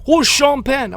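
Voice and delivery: high, loud mock German tourist; hammed-up German accent, pitched high. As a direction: imitate a baffled German friend, loudly. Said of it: "my two German friends".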